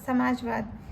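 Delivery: normal talking voice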